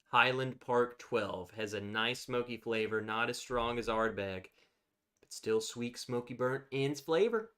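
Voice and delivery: normal talking voice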